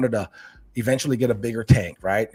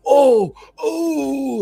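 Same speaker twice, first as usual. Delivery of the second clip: high, drawn-out "oh" of spectators; excited cheering; 6 dB louder than usual. Retold or imitated. Kids watching the Oscar go after fish, excited cheering.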